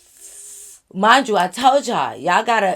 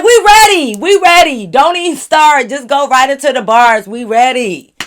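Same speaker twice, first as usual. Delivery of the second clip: loud, much higher hype call; hyped; 4 semitones higher, 9 dB louder than usual. Real, not acted. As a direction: push him to start the bars now. Hyped urging him to start.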